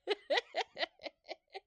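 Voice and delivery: high burst of laughter. Real, not acted. Laughing at childhood fear.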